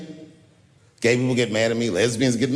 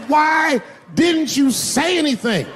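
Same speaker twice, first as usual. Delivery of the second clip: high, loud, slow complaint; mock outrage; 11 semitones higher, 3 dB louder, slower than usual. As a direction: demand it in comic dismay. Confronting dance partner.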